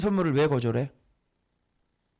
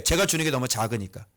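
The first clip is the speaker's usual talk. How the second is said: ordinary delivery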